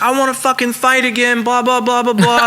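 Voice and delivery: high, whiny mimicry of a challenger; mocking imitation. Thrown-out guy banging on the door for a rematch, mockingly imitated.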